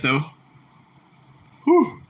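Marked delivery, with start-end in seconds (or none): 1.6-2.0 high whew. Real, not acted. Repeated exclamation.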